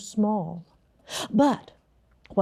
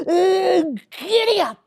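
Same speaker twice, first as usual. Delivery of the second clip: giddy up call; 8 semitones higher than usual. Acted. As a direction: urge the sleigh forward. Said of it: "Then the Grinch said".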